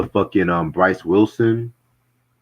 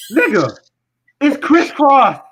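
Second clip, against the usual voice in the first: loud retort; 5 dB louder, slower than usual. Retold or imitated. Retorting to the angry commenter.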